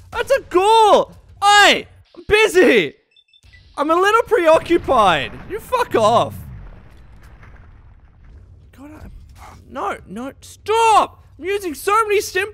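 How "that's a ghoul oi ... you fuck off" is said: high, loud alarmed shout; startled yelling. Real, not acted. Gamer spotting a ghoul mid-fight, startled shout. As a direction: yell in alarm.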